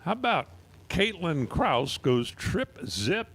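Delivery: high, drawn-out excited announcer call; slow, high hype call for a perfect reaction time. Real, not acted. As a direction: stretch it out to celebrate the perfect light. Announcer hails a perfect run.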